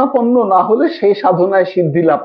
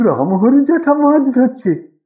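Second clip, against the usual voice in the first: higher, admiring gasp of surprise; admiring wonder; 6 semitones higher than usual. A narrator's reading. Boy points out uncle, in admiring wonder. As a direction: marvel at the old fisherman. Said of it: "প্রশংসাসূচক বিস্ময়ের সুরে বললে".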